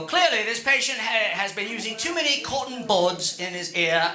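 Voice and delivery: lightly put-on English accent, a hint of a doctor; a touch of a British ear doctor explaining; mild English accent. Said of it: "he speaks in an English accent".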